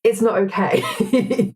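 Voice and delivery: a rueful laugh while scolding; laughing disapproval. Real, not acted. Guest laughing as she rejects online self-righteousness, laughing.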